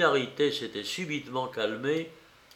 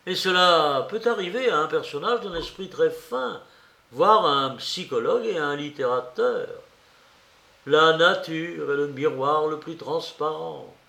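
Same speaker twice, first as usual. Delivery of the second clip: animated, higher-pitched speech with little chuckles; sly, needling investigator; 4 semitones higher, 5 dB louder than usual. A narrator's reading. Investigator toys with his suspect in a long speech, sly and amused. Porphyre's monologue read in character.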